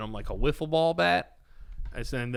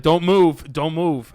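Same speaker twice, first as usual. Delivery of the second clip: louder commanding doctor; firm order; 2 semitones higher, 10 dB louder, slower than usual. Retold or imitated. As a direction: order the patient to keep still. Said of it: "He was like".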